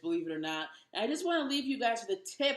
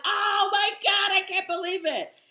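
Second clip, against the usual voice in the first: freaking out; 9 semitones higher, 7 dB louder than usual. Retold or imitated. Cleaning a chicken with its head on, freaking out loud. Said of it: "I'm freaking out like".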